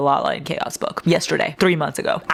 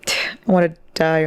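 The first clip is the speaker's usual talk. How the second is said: normal talking voice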